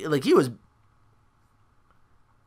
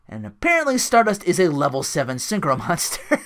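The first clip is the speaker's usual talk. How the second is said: ordinary talk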